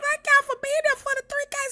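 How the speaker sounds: high squealing laugh into thanks; excited squeal. Real, not acted. Streamer thanks viewers for three K, squealing happily. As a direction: squeal with real joy. Celebrating reaching three thousand.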